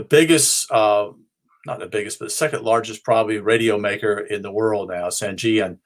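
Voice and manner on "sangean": normal talking voice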